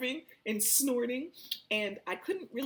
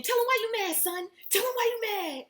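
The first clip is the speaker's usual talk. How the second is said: high, loud, laughing taunt; laughing catchphrase; 5 dB louder than usual. Retold or imitated. To her ranting partner, a high laughing taunt. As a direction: egg him on, cracking up.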